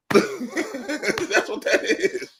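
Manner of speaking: words squeezed out slowly through laughter, pitch up; can barely finish the line for laughing. Real, not acted. Host cracking up at his Jordan-Pippen joke, laughing.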